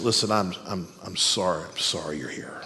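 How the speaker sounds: low, very soft condolence; hushed pastoral voice; pastoral sympathy. Retold or imitated. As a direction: gently console someone in a hospital bed. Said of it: "say it with my most pastoral voice".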